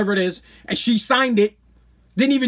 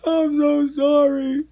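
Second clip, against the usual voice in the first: slow, high mock wail; whining apology; 6 semitones higher, much slower than usual. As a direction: imitate a mother begging for sympathy, dragging it out. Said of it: "Whining like".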